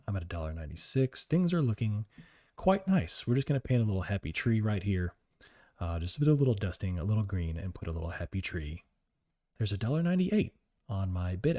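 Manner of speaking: soft, low, soothing TV painter voice; Bob Ross. Acted. Trader calling his trade as painting a happy tree, hushed painter impression. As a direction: murmur it gently like a calm painting host. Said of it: "if you covered every day as Bob Ross".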